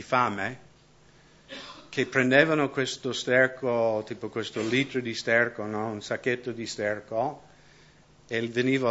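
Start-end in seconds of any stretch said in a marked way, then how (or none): none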